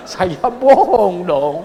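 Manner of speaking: slow, loud words through laughter; laughing. Real, not acted. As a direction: admit it while laughing. Laughing admission of lie. (sambil tertawa malu)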